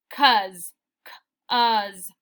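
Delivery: 'cause read as "kuhz", short uh vowel; wrong reading of 'cause. Shown as a demonstration. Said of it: "it's not 'cause".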